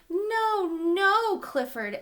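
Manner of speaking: high, loud alarmed no; loud high shout to stop the dog. Acted. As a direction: call out to stop the puppy. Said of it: "Emily Elizabeth shouted".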